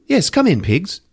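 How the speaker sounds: high-pitched mock British accent; British dean. British dean invites the cops in, high mock accent. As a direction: play a comic British dean calling them in. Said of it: "So the dean is British".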